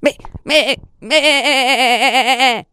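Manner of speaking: high, quavering long bleat; emotional stuttered baa; bleating lamb. A narrator's reading. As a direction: bleat the word like an upset lamb. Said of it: "bêla l'agneau tout ému".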